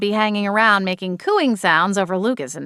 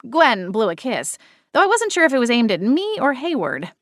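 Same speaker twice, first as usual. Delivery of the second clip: usual speaking voice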